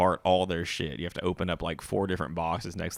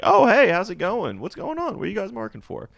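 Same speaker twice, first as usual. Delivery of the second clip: much higher, bright friendly voice; friendly curious neighbour; 12 semitones higher, 5 dB louder than usual. Retold or imitated. Neighbour curiously greeting the worker in the yard. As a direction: play the nosy but friendly neighbour. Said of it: "They're like".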